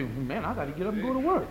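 a soft, laughing mock grumble; a muttered excuse with a chuckle in it; half-laughing whiny excuse; lazy excuse. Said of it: "I don't expect you to say".

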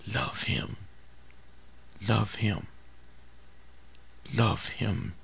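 low, soft and tender; soft plea. Real, not acted. Preacher softly urging the congregation to love, quiet. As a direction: gently urge them to love. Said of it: "repeated gentle plea".

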